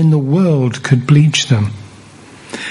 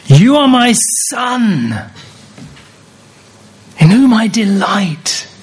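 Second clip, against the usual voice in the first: voice of heaven; 6 semitones higher than usual. Acted. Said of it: "And now heaven is going to say".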